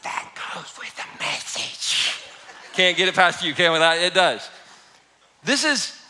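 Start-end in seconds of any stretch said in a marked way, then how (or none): 0.0-2.2 mock whispered aside. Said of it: "Some of y'all like".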